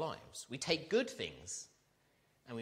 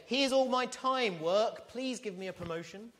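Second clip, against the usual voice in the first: raised, loud, slow plea to work as if to an idol; hammed-up desperate worker offering up his time; mock pleading worker; 9 semitones higher, 6 dB louder, slower than usual. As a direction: beg the job for a promotion like an offering. Preacher voices worker pleading with work.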